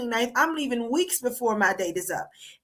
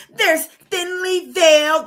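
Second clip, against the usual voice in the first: slow, louder, higher mimicry of the guest; mocking robotic pundit; 5 semitones higher, 6 dB louder, slower than usual. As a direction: parrot her words like a stiff robot. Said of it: "They always speak like fucking robots".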